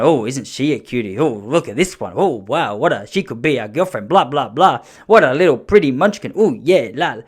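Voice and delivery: sing-song mock cooing, swooping up and down; playful teasing. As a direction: coo teasingly as a joke for the boy. Re-enacts teasing about a girl.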